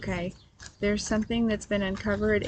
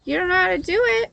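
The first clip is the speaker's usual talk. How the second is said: playful surprise; 9 semitones higher, 6 dB louder, faster than usual. Real, not acted. Child forgot magic trick.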